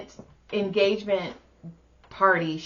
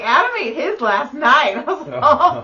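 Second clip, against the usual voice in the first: telling the punchline with a laugh; 5 semitones higher, 7 dB louder than usual. Real, not acted. Finishing the Snickers story, laughing through.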